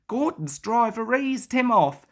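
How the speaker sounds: usual speaking voice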